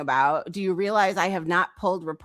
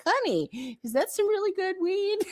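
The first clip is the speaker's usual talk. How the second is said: higher, sing-song mock concern; mock sweet mocking; 9 semitones higher than usual. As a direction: tease her like a syrupy auntie. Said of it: "She's just like".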